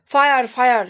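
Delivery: reading out a loud cry of fire; shouted. A narrator's reading. Taffy sees smoke from doll's house, shouting. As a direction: call out the alarm, loud and urgent. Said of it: "shouted toffee".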